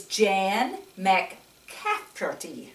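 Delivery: put-on reading the name slowly for the children; slow careful name. Shown as a demonstration. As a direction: spell out Jan McCafferty slowly. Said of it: "That's kinda hard for Gigi to say".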